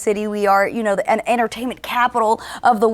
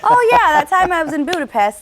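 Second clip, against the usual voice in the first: higher-pitched, teasing imitation of a casual brag; mock nonchalance; 7 semitones higher, 4 dB louder than usual. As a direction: toss it off as if it were nothing, playfully. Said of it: "like you're just walking to the park".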